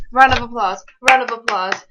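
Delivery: loud, proud call for applause; rally the viewers to clap; excited cheer. Real, not acted. Gamer cheering his first trick to viewers, loud excited call.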